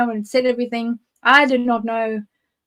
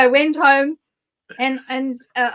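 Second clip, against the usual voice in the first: normal talking voice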